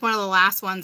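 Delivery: ordinary talk, nothing marked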